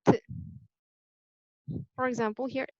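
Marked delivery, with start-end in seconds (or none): 0.1-0.2 "t" as a bare voiceless release; isolated t sound. Shown as a demonstration. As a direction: demonstrate "t" as the sound, not the letter's name. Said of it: "we're gonna pronounce the E D as t".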